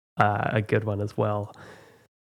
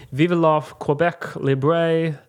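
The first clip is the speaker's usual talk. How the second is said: slow, careful French pronunciation; French accent attempt; 4 semitones higher, much slower than usual. Shown as a demonstration. Host reading the quiz quote with a careful French accent. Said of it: "my French accent".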